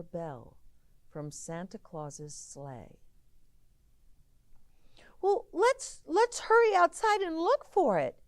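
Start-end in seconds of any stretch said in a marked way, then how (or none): 5.2-8.2 put-on high, loud eager child; child's voice. A child urging the others to search outside. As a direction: pipe up like an excited kid. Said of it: "one of the children said".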